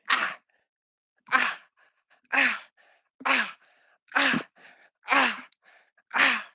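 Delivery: short, high "ah" bursts; play-fight yelps. Pretend. Dad play-sparring with his boy, high yelps. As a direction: yelp once per hit, high and quick.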